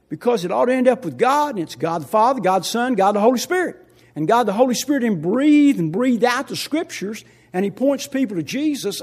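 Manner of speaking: loud, building sermon cadence; preaching at full force. Acted. Pastor preaching the Trinity to the church, loud and rising. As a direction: preach it out to the congregation.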